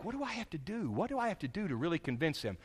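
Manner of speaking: hushed, mildly anxious self-questioning; nervous thought. Acted. Believer wondering how to convince someone, acted as hushed nervous thinking. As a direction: drop to a soft, worried inner voice. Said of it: "we get nervous and we think".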